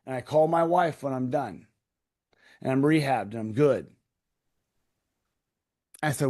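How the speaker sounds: ordinary talk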